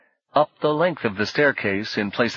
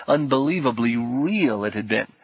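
usual speaking voice

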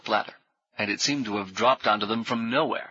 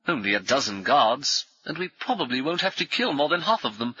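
lively, swooping high pitch; hearty, cheerfully reckless; breezy adventurer; 6 semitones higher than usual. A narrator's reading. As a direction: explain a wild plan as if obvious. Brummond breezily explains rescue plan.

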